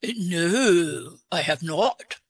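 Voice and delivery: put-on disguise the voice with an English accent. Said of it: "Where did you get your English accent?".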